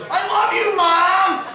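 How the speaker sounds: put-on high-pitched, jubilant child voice; gleeful yell to the camera; excited kid's shout. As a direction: shout it high like a thrilled boy.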